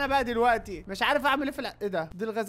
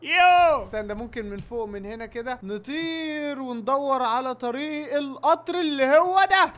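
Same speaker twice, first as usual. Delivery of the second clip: ordinary talk, nothing marked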